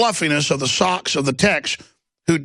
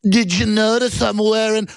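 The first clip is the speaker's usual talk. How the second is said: higher, sweet, innocent voice; mock innocent exec; 6 semitones higher than usual. Mocking tech people out front, higher and sweetly innocent. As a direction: play dumb and harmless. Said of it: "they're like".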